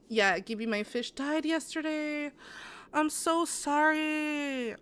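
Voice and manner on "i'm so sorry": drawn-out high sorry; exaggerated sympathy. Real, not acted. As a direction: stretch it out with pity. Sympathy to chat's dead fish.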